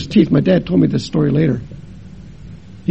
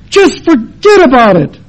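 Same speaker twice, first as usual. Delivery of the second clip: brushing off; 7 semitones higher, 8 dB louder than usual. Retold or imitated. Mother brushing off question.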